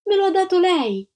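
high-pitched shouted protest; indignant cry. A narrator's reading. Antonella says the egg was given, indignant cry. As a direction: shout it out in self-defence. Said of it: "gridò Antonella".

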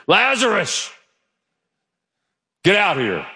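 high sudden call of a name; loud call. Retold or imitated. Preacher calling Lazarus to scare the church. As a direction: startle them with the call. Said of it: "I want to scare you".

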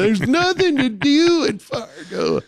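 high-pitched whiny imitation; mock whining. Locals complaining about Fargo in his ear, mock whining. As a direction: mimic a complainer, pitched well up. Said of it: "all of those who I always hear whining".

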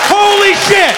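very high-pitched amazed yell; astonished shout. Acted. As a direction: explode with disbelief. Comic erupts at the cop's term.

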